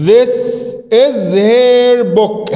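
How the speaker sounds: very slow, drawn-out teacher model; slow model sentence. Shown as a demonstration. Teacher modelling the English sentence slowly. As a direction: say it word by word. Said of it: "أقول إيه؟".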